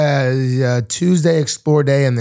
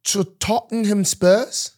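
higher, slow fake London accent; hammed-up London accent; mock London accent; 6 semitones higher, slower than usual. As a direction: pronounce it slowly in a put-on London accent. Club name in mock London accent.

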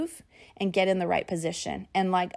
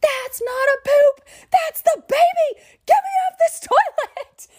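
yelling; 8 dB louder than usual. Retold or imitated. Labouring wife to husband about the toilet, yelling. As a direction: re-enact a frantic yell from labour. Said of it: "I yelled to my husband".

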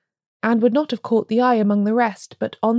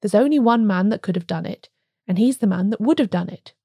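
ordinary delivery

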